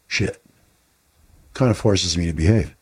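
ordinary talk, nothing marked

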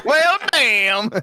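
high, loud Southern drawl; quick Southern "Well, damn" with a jump up in pitch; Southern accent. Acted. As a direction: lay on a thick Southern twang. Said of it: "do the Southern one".

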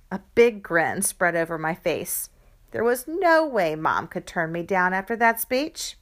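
plain reading voice

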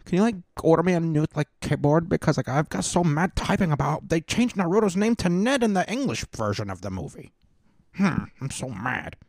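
high, whiny nerd talking to Siri; mocking fan voice. Host mocking an upset anime fan talking to Siri, high whiny voice. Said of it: "have to tell Alexa or the Siri, like".

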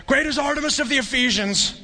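high-pitched mock crowd chant; crowd crying out. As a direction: imitate an enraged mob's cry without full volume. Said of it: "enraged and were crying out".

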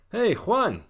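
high, loud hail across the street; loud friendly call from behind. Acted. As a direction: shout to get his friend's attention.

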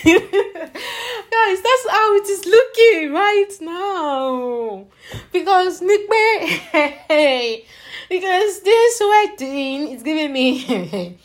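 high-pitched laughing through the words. Real, not acted. Ranting to viewers about wedding chaos, laughing through it. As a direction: laugh helplessly while trying to get it out.

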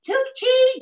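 calling her name high, a light imitation; a lightly voiced older actress, high; Dodie Goodman voice. Older actress urging her to take the job, lightly voiced high. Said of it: "And she said to me".

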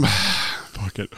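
ordinary talk

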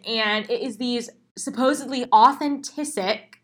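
ordinary talk

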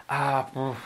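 normal talking voice

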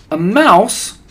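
high, loud delighted disbelief; astonished cry. A narrator's reading. Witches reacting to the child becoming mouse, astonished. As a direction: leap up in pitch with amazement. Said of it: "cried the witches".

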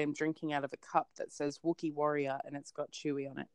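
usual speaking voice

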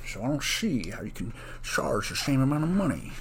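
slurred Scottish movie-star impression; hammed-up Scottish screen-legend voice; bad Sean Connery. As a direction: do a poor Scottish accent with shushed S sounds. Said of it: "That's a really bad Sean Connery impression".

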